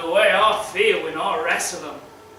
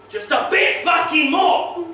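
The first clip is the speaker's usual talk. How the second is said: loud, high outburst on "fucking"; desperate shout; 7 semitones higher, 4 dB louder than usual. Acted. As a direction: let the plea snap into a yell. Desperate pleading bursts into rage.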